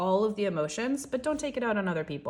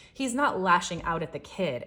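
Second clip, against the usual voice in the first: ordinary delivery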